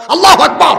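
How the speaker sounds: high, loud burst; exclaimed takbir. Real, not acted. Preacher hails the one true God, loud cry. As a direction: cry it out suddenly.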